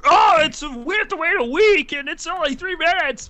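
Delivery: high, loud whiny complaint; mock viewer grumbling, high-pitched; whiny complaining fan. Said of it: "a lot of you guys were like".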